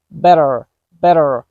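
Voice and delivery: better with the T tapped as a quick D; fast D sound. Shown as a demonstration. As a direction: flap the T in better. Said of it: "a fast D sound".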